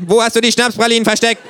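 viel höher, lauter und schneller; drängend laut. A narrator's reading. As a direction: fordernd herausgepresst. Känguru wiederholt drängender. (Känguru verhört den Erzähler, laut drängend)

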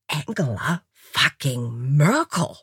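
slow, drawn-out grumble; muttering disbelief. A narrator's reading. American leaving the room, slow stunned mutter. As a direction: say it slowly to yourself, stunned. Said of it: "murmelte dabei".

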